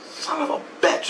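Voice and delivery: high-pitched retold cursing; exasperated curse. Husband reacts to being ordered back, high exasperation. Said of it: "And I'm like".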